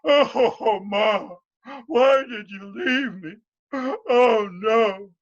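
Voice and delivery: loud high sobbing lament; crying his eyes out. Acted. Morgan sobbing by the wall over his mother. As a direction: sob it out, heartbroken. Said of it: "began to cry his eyes out".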